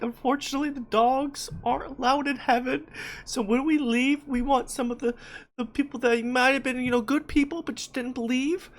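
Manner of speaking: mock sales pitch voice. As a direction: lightly play a cheesy startup pitch. Voicing the pet startup pitch.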